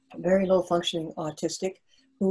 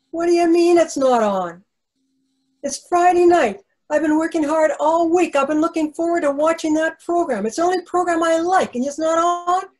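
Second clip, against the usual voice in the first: indignant protest, much higher and louder; retold worked-up outburst; 8 semitones higher, 6 dB louder than usual. Recalling how she got worked up when her favourite show was off, indignant and loud. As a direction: let the outrage burst out over the cancelled show. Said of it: "And I got all worked up".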